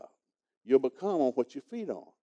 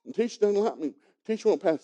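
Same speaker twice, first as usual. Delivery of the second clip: mock a sulky kid complaining; a hint of a pouting child; whiny kid; 8 semitones higher, 3 dB louder than usual. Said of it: "they just say the dumbest stuff".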